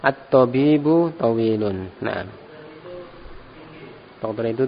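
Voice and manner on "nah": normal talking voice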